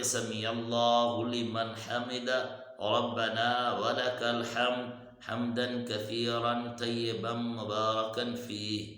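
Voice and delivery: slow, clear سمیع اللہ لمن حمدہ; unhurried recitation with clear sounds; slow and careful. Shown as a demonstration. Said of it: "آرام سے پڑھنا ہے". (آرام سے صاف تلفظ)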